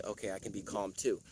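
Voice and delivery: a hushed, settled calm. Retold or imitated. Calm library crowd, calm voice.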